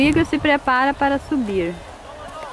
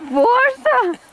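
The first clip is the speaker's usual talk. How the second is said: cheering; 4 semitones higher, much slower than usual. Real, not acted. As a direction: cheer him up onto his feet. Cheering him up from snow.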